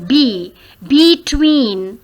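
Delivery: "B" alone, then slow "between" with a long "tween"; split into syllables. Shown as a demonstration. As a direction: break "between" after "B", stretching "tween". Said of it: "There are two syllables in B and tween". ("between" को "B" और लंबे "tween" में तोड़कर)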